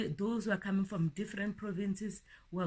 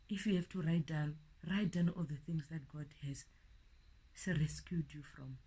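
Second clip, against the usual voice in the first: whisper; 4 semitones lower, 7 dB quieter than usual. Real, not acted. Intimate urging to write down.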